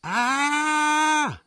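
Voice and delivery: high, drawn-out wail of horror; anguished scream. A narrator's reading. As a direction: scream it out slowly in utter despair. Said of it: "schrie er". (verzweifelter, hoher, gedehnter Schrei)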